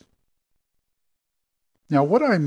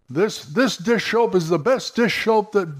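higher hammed-up ad pitch; huckster pitch; 7 semitones higher than usual. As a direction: sell the soap like a snake oil salesman. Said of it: "like they're a huckster".

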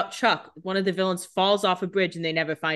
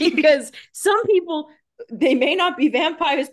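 laughing remark; 8 semitones higher, 5 dB louder than usual. Real, not acted. Joking amid shared laughter.

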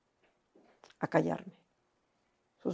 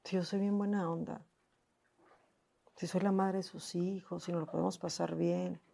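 retold crying lament; 10 dB quieter than usual. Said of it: "Y yo lloraba y decía".

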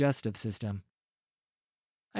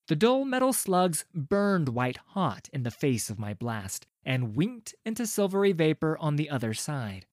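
ordinary delivery, nothing marked

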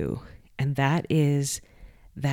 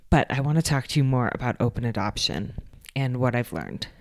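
usual speaking voice

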